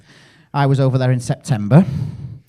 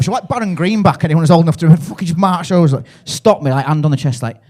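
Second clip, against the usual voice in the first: usual speaking voice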